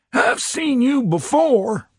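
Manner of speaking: very high, slow, squinting voice; high, slow, suspicious chief; puzzled fat chief. A narrator's reading. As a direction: peer up at him and drag it out, pitched high.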